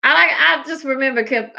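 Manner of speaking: normal talking voice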